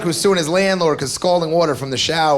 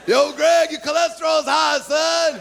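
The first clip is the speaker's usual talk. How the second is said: mock street yell; 7 semitones higher, slower than usual. Imagined kid yelling health news.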